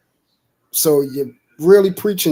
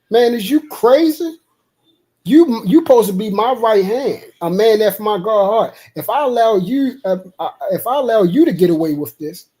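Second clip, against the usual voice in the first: rebuking God; 5 semitones higher than usual. Retold or imitated. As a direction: scold like an angry Lord, pitched up. God rebuking David in story.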